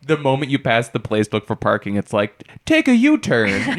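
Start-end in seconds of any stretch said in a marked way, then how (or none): 2.6-3.8 high, slow mock-British GPS; British navigation voice. Maps app interrupting while parking, mock-British voice. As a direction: imitate a British GPS, pitched up and drawn out. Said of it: "It was a male British voice".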